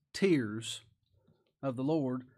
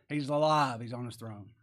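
usual speaking voice

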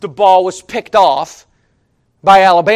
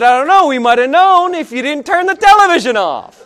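higher, exasperated comeback; exasperated retort; 5 semitones higher, 4 dB louder than usual. Retold or imitated. Preacher mimics scolding his friend over the TV, exasperated. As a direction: throw it back at him, half amused.

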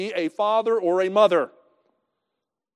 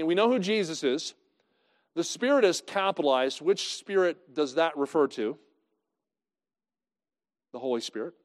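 usual speaking voice

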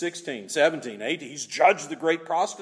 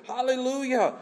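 high-pitched cry of praise; 8 semitones higher than usual. Acted. Preacher quoting the second hallelujah, crying out high. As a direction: lift it high like a crowd's acclamation. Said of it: "They cried out".

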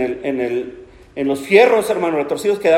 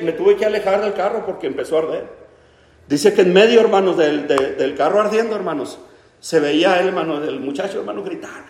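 usual speaking voice

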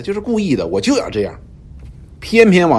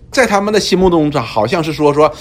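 usual speaking voice

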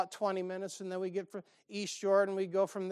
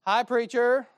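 cheerful lady greeting; 4 semitones higher, 8 dB louder, slower than usual. Retold or imitated. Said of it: "this colored lady walks up there".

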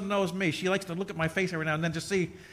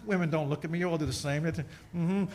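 usual speaking voice

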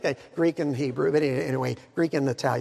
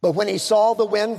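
ordinary talk, nothing marked

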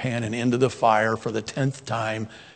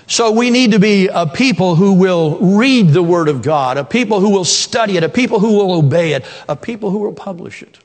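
louder, higher rising sermon build; preaching at full force; 7 semitones higher, 7 dB louder than usual. Acted. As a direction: drive the list home from the pulpit. Preaching climax.

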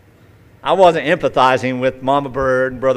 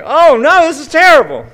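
much higher and louder, aghast; horrified cry; 10 dB louder than usual. Retold or imitated. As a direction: wail in horror at what you did. Teen horrified at dead bird.

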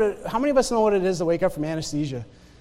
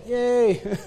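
sarcastic yay. Shown as a demonstration. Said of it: "That was sarcasm".

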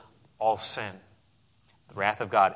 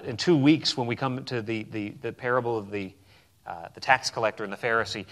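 ordinary delivery

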